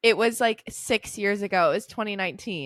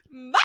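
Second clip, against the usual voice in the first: screaming goat wail; 4 semitones higher than usual. Retold or imitated. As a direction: bleat out a failed high note like a screaming goat. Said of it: "sounded like those screaming goat videos".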